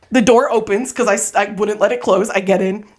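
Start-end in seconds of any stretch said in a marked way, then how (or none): none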